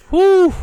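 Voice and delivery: sharp high exhale of breath; winded whew. Real, not acted. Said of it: "out of breath".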